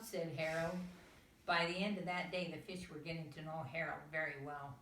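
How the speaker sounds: ordinary delivery, nothing marked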